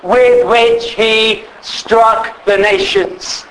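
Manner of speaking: slow, weighty scripture reading; solemn scripture reading. Real, not acted. Preacher reading Revelation to the congregation, slow and solemn. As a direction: let each word of the verse land slowly. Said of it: "It says".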